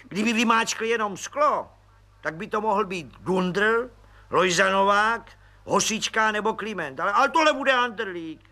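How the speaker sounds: high, eager voice of a cheeky little policeman; high cheeky detective voice; grinning Pištoras. A narrator's reading. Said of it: "nadšeně".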